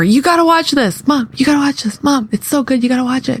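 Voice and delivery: put-on high, insistent kid begging; eager kid. Kids pushing mom to watch a YouTube video, high and insistent. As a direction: pester mom over and over like an excited kid.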